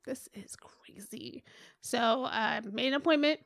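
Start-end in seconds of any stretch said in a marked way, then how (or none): 0.1-1.4 slow, hushed disbelief; whispered aside to yourself; stunned whisper. Retold or imitated. Stunned reaction to pregnancy tests.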